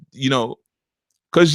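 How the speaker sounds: ordinary talk, nothing marked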